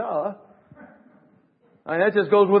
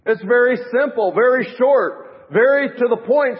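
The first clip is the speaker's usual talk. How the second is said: emphatic preaching; 5 semitones higher, 6 dB louder than usual. Real, not acted.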